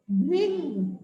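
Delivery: भृंग as "bhring", low and falling; correct pronunciation. Shown as a demonstration. Teacher answering her own pronunciation question, भृंग said "bhring". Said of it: "इसे हम क्या pronounce करेंगे?".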